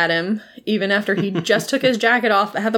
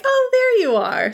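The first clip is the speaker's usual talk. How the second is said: very high, sing-out greeting; mock delighted discovery. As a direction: imitate a happy find in a high voice. Captain finds missing crewman, retold.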